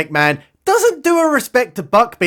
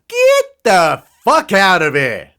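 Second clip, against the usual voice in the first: slow, drawn-out incredulous dismissal; scornful disbelief; slower than usual. Real, not acted. Narrator scoffing at how easily rebels are found, scornful disbelief. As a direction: stretch each word in exasperated scorn.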